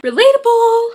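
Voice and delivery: high, loud mock cheer of relatable; catchphrase shout. Vlogger shouting her running joke: high loud relatable. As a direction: belt out relatable as a silly catchphrase.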